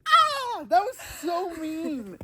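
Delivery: high, slow wailing complaint; whiny complaint. Real, not acted. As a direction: whine like you were wronged. Just soaked in snow.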